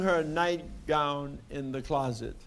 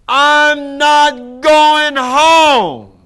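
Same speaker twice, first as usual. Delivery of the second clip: loud, high, slow defiant shout; 11 semitones higher, 16 dB louder, much slower than usual. Acted. Shy Daisy finally shouting back at her family, loud. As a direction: plant your feet and yell it out. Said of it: "shouted Daisy".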